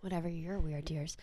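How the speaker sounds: breathy, unvoiced sulking mutter; mock sulky whisper. To her cohost after being teased, whispered mock sulk. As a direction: whisper it back like a pouty grudge.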